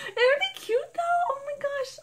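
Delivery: giggling high, bright and loud; high laugh. Real, not acted. Joking about a paid jingle, giggling high. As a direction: laugh it off sweetly. Laughs at her own joke.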